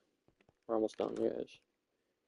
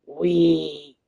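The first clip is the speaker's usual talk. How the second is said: higher, louder joyful wee; excited wee; 5 semitones higher, 4 dB louder than usual. Real, not acted. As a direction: squeal with delight on the drop. Riding down the fun course.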